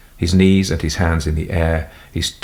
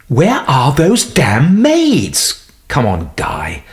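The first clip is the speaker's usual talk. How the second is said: slow, loud, higher exasperation; irritated grumble; 7 semitones higher, 4 dB louder, much slower than usual. Acted. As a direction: grumble about the maids. Doctor irritated at maids.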